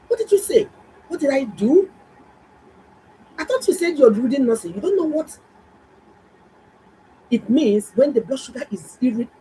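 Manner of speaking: high, loud, indignant scolding; indignant retort. Retold or imitated. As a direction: tell her off in disbelief. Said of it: "I said".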